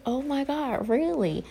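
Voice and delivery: high, thrilled retold reaction; excited disbelief. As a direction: squeal it like you can't believe the good news. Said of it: "I'm like".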